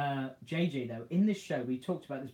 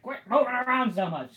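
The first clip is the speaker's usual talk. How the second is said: higher, louder irritated bark; mock yell; 8 semitones higher, 6 dB louder than usual. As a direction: snap at the wriggling target. J J yelling at Spider-Man.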